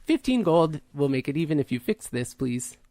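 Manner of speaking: halting words, pitch lurching up mid-phrase; slow android with a glitching voice, polite but fed up; malfunctioning android woman. Acted. Asking the party to repair her, glitching android.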